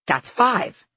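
high, loud shout. A narrator's reading. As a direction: call out the count. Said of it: "she shouted".

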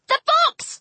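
sudden high-pitched cry of alarm; urgent yelp. A narrator's reading. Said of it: "I yelped".

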